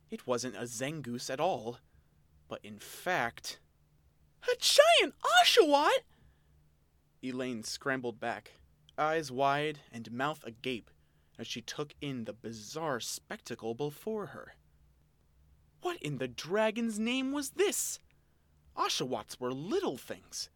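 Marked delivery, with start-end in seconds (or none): 4.4-6.0 shrieked reveal, high and loud; shocked exclamation. A narrator's reading. Narrator's big reveal. 15.8-18.0 higher, bewildered question; incredulous. Acted. Elaine's inner shock.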